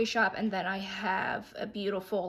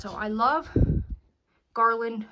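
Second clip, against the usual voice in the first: breathless speech; out of breath; 5 dB louder than usual. Real, not acted. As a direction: talk while short of breath. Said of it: "I sound like I'm out of breath".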